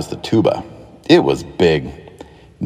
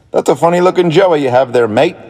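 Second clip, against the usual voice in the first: higher, teasing kangaroo voice; kangaroo character voice; 8 semitones higher, 3 dB louder than usual. A narrator's reading. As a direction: poke fun gently, pitched up. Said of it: "said one of the kangaroos to Lisa".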